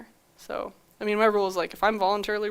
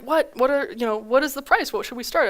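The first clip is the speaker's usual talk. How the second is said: surprised; 5 semitones higher, 4 dB louder than usual. Retold or imitated. Buyers caught off guard by deadline.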